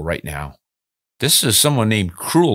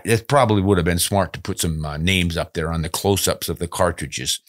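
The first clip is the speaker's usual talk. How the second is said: ordinary talk, nothing marked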